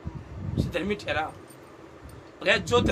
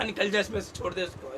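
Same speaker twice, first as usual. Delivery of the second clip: soft, coaxing plea, put on; mock loving request; 2 semitones lower, 8 dB quieter than usual. Shown as a demonstration. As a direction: ask it sweetly and quietly, as a wrong example. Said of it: "बड़े प्यार से नहीं कहा". (प्यार भरी धीमी गुज़ारिश का नाटक)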